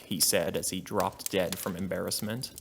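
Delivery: ordinary delivery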